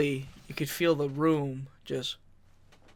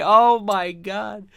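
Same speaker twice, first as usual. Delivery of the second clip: slow amazed oh my God; 5 semitones higher, 8 dB louder, much slower than usual. Retold or imitated. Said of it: "I'm like".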